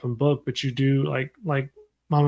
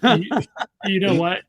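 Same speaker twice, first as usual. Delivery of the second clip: laughing; 5 semitones higher, 4 dB louder than usual. Real, not acted. Host laughs at co-hosts' joke, laughing. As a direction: break into a laugh before the point.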